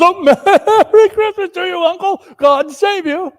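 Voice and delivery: high, loud, beaming holiday greeting; cheery nephew. Acted. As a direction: burst in full of cheer on Uncle. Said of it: "in the cheeriest of tones".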